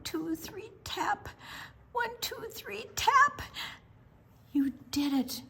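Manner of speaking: ordinary delivery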